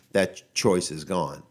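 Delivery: ordinary talk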